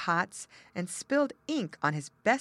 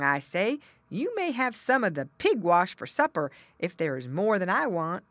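jolly pig; 4 semitones higher, 7 dB louder than usual. Acted. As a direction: tease like a pushy pig. Pig brother mocks child.

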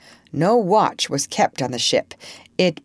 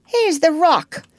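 high, loud, slow pirate call; Smee's cry; 12 semitones higher, 5 dB louder than usual. A narrator's reading. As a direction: call it out to a crewmate. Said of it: "cried an Irish voice that was Smee's".

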